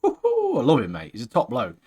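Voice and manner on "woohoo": sudden whoop, far higher; a delighted whoop for his hero; cheer. Real, not acted. Cheering for favourite character.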